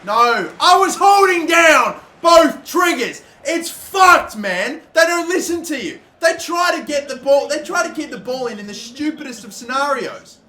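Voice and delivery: loud, high yelling complaint; real exasperation shouted out; furious rant. Real, not acted. Gamer ranting at the game's broken controls, loud and furious.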